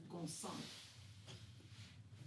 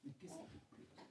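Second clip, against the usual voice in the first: whispered question; 11 semitones higher, 13 dB quieter than usual. Acted.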